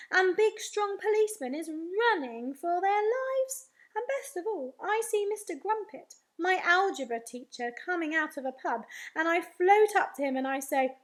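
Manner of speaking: ordinary delivery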